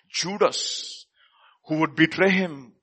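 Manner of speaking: ordinary talk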